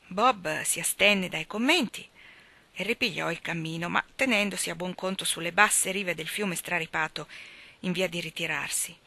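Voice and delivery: plain reading voice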